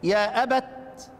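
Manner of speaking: يا أبت drawn out very slowly; a slow, careful teaching demo; slow demo stop. Shown as a demonstration. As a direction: stretch out each يا أبت as you stop on it. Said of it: "إذا وقف على كلمة".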